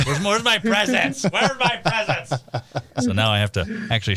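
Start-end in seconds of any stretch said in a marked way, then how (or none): 0.1-2.3 put-on high whiny kid voice; bratty kid. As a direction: whine it like a spoiled child. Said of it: "like a bratty kid on Christmas".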